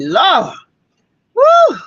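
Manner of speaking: high, loud whooping woo; a high, loud exclamation at the heat; retold woo at the heat. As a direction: cry out woo like the sun is beating down. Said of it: "that sun was ruthless".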